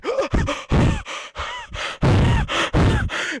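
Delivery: sharp high gasps fighting for breath; gasping for air. Retold or imitated. Scared of choking, gasping after the shellfish. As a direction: gasp like your throat is closing up. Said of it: "came upstairs gasping".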